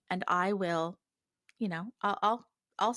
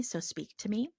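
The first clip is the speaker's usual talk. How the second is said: usual speaking voice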